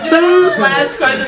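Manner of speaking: laughing. Real, not acted. Laughing, slow, mock crying.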